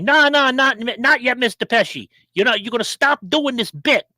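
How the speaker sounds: high, insistent mock scolding; Joe Pesci. Star ordering the comic to drop the bit, high-pitched scolding impression. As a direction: cut him off in a high-pitched, agitated impression. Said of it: "And Pesci's like".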